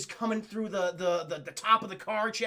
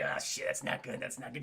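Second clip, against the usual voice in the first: low, soft worried aside; muttered Sam Neill impression; 7 semitones lower, 8 dB quieter than usual. Imitating him watching the T-Rex attack, low and muted. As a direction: drop low and quiet, quietly concerned. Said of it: "Samuel's like".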